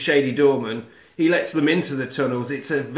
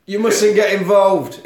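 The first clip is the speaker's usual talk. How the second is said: slow, louder attempt at French accent, moderately raised; deliberate mock-French accent, less squeaky; second French attempt; 5 semitones higher, 6 dB louder than usual. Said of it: "I can't do a French accent".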